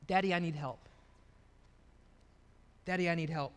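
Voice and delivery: low, soft acted surrender; humble plea. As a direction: quietly ask a father for help. Voices a humble plea to God.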